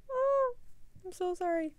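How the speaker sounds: high-pitched, exaggerated little apology; small high voice saying sorry; pleading apology. Apologizes for missing test deadline.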